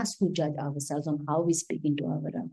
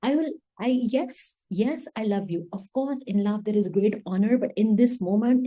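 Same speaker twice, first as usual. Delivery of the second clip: ordinary talk, nothing marked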